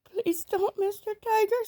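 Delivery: put-on high little boy begging; small boy pleading. A narrator's reading. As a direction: squeak out the plea to the tiger. Sambo's plea in story.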